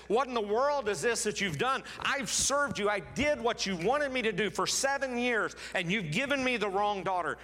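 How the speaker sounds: Jacob venting his outrage at being cheated; outraged. Acted. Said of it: "He's outraged. He's mad".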